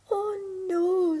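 high, loud dismayed oh no; dismayed cry. Acted. Thumbelina refusing the beetle, high dismayed cry. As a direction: wail it in dismay.

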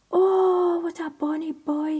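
put-on lightly wheezed, fond old crone; a hint of a wheezy beggar woman; wheezing old woman. Old beggar woman cooing over the cradle, lightly wheezed. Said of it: "she wheezed".

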